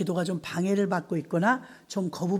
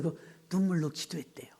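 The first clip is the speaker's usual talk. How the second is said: whisper; 4 semitones lower, 8 dB quieter than usual. Real, not acted. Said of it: "unvoiced whisper".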